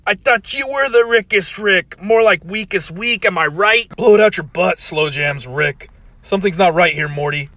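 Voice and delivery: high, loud smug taunt; cocky Rick voice. Acted. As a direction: sneer it like a swaggering show-off.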